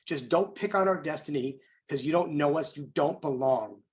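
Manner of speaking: usual speaking voice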